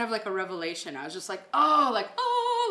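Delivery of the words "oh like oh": surprised oh. Retold or imitated. Relieved revelation exclamation.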